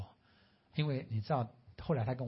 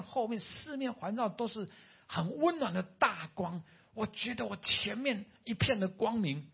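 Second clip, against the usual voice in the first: higher, louder, voice lifting with wonder; awed excited retelling; 8 semitones higher, 3 dB louder than usual. Retold or imitated. Dying patient tells the doctor of the light, awed and excited. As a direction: relive the moment the light broke in.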